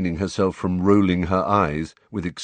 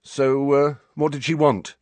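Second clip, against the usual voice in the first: higher, feigned casual question; mock casual; 8 semitones higher, 3 dB louder than usual. A narrator's reading. Friend asks what she wanted, mock casual. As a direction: act casual while prying. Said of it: "in a mock casual voice".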